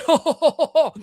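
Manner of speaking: high hooting ho ho laugh; mock laughing ant language. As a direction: hoot a silly high laugh. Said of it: "That's a laughing ant language".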